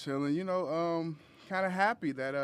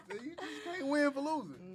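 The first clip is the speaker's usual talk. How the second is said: laughing; 11 semitones higher, 4 dB louder, slower than usual. Real, not acted. Joking about being too sensitive.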